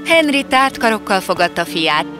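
usual speaking voice

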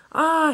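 high-pitched loud scream; short story-time yell of fright; frightened yell. A narrator's reading. Engine hearing clanking metal in the fog, frightened yell. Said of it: "he yelled".

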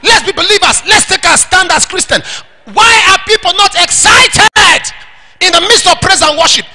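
high, ringing challenge to the congregation; fired-up pulpit exhortation; impassioned preaching. Real, not acted. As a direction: preach it high, stretching the big question.

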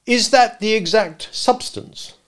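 high, slow, pointed question; biting cross-examiner; bitter. Acted. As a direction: press scornfully word by word. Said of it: "repeated the gentleman bitterly".

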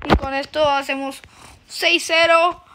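ordinary delivery

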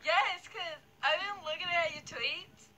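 ordinary delivery

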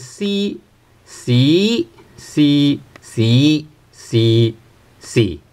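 C sung through all six tones, one after another; six Cantonese tones. Shown as a demonstration. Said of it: "C has six tones".

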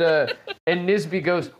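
ordinary delivery

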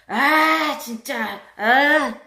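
loud, high irritated outburst; annoyed loud groan. Retold or imitated. Passenger fed up with a crying baby, loud annoyed groan. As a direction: groan loudly, fed up with the noise. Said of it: "엄청나게 큰 소리를 내는 사람".

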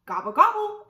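high, loud turkey gobble; gobbling turkey. Acted.

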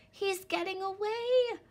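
high, loud desperate begging. A narrator's reading. Little Wolf to his parents, desperate loud begging. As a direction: beg before he's gone. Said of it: "Little Wolf begged".

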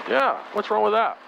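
ordinary delivery

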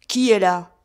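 crié fort et bourru, plus fort; cri peu avenant. A narrator's reading. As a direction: aboie la question au visiteur. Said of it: "cria une voix peu avenante". (crié sèchement, d'une voix peu avenante)

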